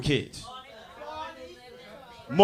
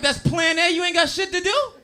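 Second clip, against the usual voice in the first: high, loud disbelieving question; incredulous; 11 semitones higher, 3 dB louder than usual. Retold or imitated. Incredulous retold reply.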